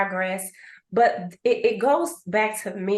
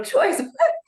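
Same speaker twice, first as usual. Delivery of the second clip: ordinary talk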